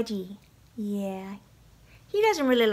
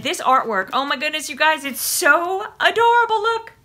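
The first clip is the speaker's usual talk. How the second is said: high, louder squeal of delight; gushing excitement; 5 semitones higher, 5 dB louder than usual. Real, not acted. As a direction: gush over something adorable. Gushing over the box artwork.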